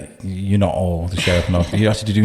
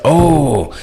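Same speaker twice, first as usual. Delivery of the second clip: surprised; 7 semitones higher, 6 dB louder than usual. Retold or imitated. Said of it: "and he was like".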